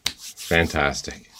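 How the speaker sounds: ordinary talk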